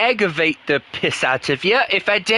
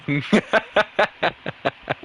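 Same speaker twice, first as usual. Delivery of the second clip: short laugh. Real, not acted. Laughing at how odd the phrase sounds in his accent. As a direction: chuckle at how wrong it sounds.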